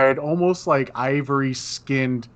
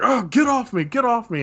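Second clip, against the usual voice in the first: much higher-pitched, flustered complaint; play-acted irritation, pitched well up; acted annoyed protest; 10 semitones higher than usual. Game master as drunk tangled with the player, high annoyed protest.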